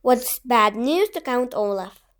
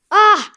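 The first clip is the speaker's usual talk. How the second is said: loud, higher Ah! roared out; villain's roar; 7 semitones higher, 11 dB louder than usual. A narrator's reading. Villain foiled in court, roaring loud Ah!. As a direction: let rip a furious villain roar. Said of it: "Count Olaf roared".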